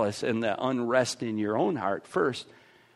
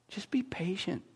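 soft whispered reassurance; 6 semitones higher, 5 dB quieter than usual. Retold or imitated. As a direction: breathe it like a gentle secret. God gently counsels patience.

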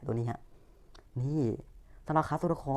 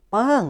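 loud vocal ding; 10 semitones higher, 9 dB louder, slower than usual. Pretend. Sound effect for evolution.

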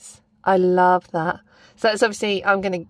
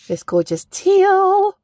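admiring coo; 11 semitones higher, 4 dB louder, much slower than usual. Real, not acted. Admiring colour in unboxing.

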